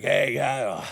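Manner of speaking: imitating a winded big guy, loud and heaving; out of breath. Bodybuilder thanking his new helper, mock out of breath. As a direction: huff between phrases like a huge man. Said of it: "All out of breath".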